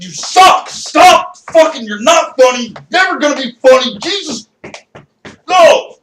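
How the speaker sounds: high, loud furious screaming; enraged heckling. Retold or imitated. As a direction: hurl it like abuse mid-beating. Acted heckler beating him.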